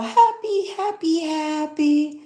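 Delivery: squeaky high mock cat, bouncing with glee; happy cat voice. Mimicking the jumping cat in a reel, high squeaky glee. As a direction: imitate a gleeful cartoon cat, way up high. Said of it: "And he's like".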